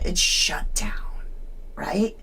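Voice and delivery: slow, low whisper; conspiratorial whisper. Acted. Streamer hints at cover-up to viewers, whispering. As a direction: let them in on a secret.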